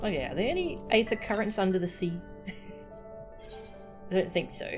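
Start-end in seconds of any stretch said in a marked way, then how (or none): none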